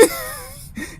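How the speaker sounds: squealing high burst of laughter. Real, not acted. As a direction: let a high laugh break out. Laughter after protest.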